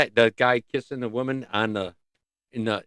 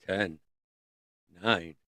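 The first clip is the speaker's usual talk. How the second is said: ordinary delivery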